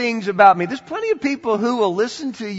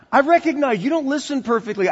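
normal talking voice

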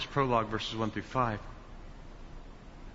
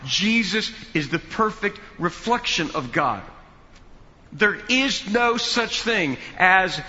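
preacher in full flight, louder and higher; full preaching; 5 semitones higher, 6 dB louder than usual. Acted. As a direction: build the sermon to a ringing climax. Preaching climax.

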